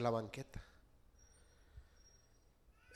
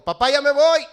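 much higher, loud call from the door; son leaving; 11 semitones higher, 19 dB louder than usual. Retold or imitated. Son calls to his father upstairs, high and loud. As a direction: call out like a kid heading out. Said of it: "A las dos me dijo".

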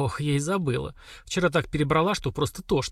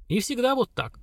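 pleased smirk; 10 semitones higher than usual. A narrator's reading. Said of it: "с удовольствием усмехнулась Герса".

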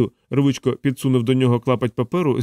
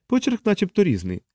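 high-pitched officer voice; lightly voiced major, pitched up; speculating major; 8 semitones higher than usual. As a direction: venture a guess in a high voice. Major's character voice.

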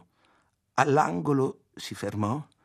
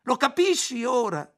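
much higher and louder, near tears; angry cry; 13 semitones higher, 6 dB louder than usual. A narrator's reading. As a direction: demand that he finally understand. Ayla turns to narrator, furious.

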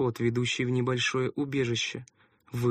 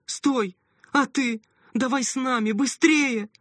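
high, loud urgent call; shouting; 12 semitones higher, 5 dB louder, slower than usual. A narrator's reading. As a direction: yell after her to come. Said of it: "закричала".

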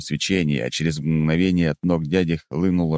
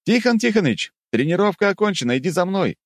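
calling out. Acted. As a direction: call out to stop the fight. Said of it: "выкрикнул я".

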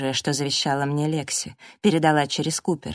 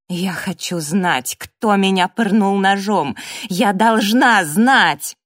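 building to a loud shout; impassioned, emphatic outburst; insistent demand; 3 semitones higher, 7 dB louder, slower than usual. A narrator's reading. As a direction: demand the truth, louder each phrase. Stabbed woman demands the truth, shouting.

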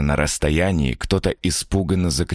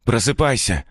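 character voice; 7 semitones higher than usual. Acted. Stranger wakes him.

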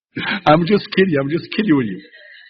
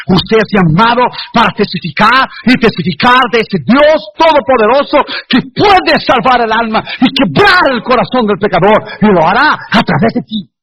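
fiery preaching; 5 semitones higher than usual. Acted. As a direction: preach with rising fire to the congregation. Preaching at full force.

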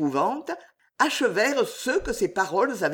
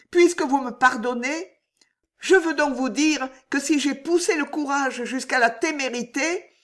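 voix de jeune femme plus aiguë, suppliante; Juliette amoureuse; 7 semitones higher than usual. A narrator's reading. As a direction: avoue ton amour au roi, plus haut. Juliette voiced by narrator. (Juliette implore le roi, voix aiguë et suppliante)

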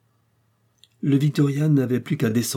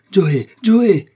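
loud alarmed shout, leaping higher; 9 semitones higher, 10 dB louder than usual. A narrator's reading. Doctor sees Joe vanish overboard, shouting. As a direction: shout the name, the second more piercingly high. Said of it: "cria-t-il".

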